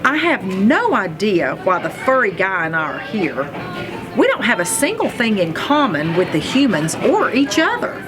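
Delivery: usual speaking voice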